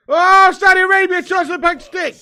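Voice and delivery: loud, high sudden yell; excited shout. Real, not acted. Streamer yelling at a Saudi attack, loud and excited.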